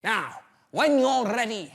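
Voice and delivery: hammed-up drama teacher. Acting teacher announcing monologues.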